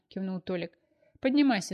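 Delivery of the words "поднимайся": loud mocking command; bossy order. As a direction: bark the order at him. Tolik ordering the student.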